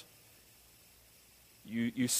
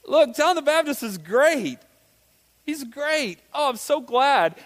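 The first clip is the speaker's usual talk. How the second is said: higher, slow, gushing mock praise; mock cheerful apostle; 9 semitones higher, slower than usual. Pastor voicing John the Apostle to the congregation, mock gushing. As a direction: sing John the Baptist's praises, slightly overdone. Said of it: "John the Apostle saying".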